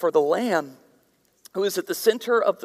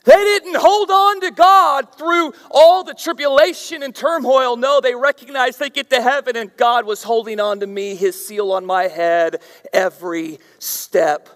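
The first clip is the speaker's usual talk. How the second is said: loud, high fiery preaching; preaching at full force; 6 semitones higher, 10 dB louder than usual. Real, not acted. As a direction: drive each line home to the congregation.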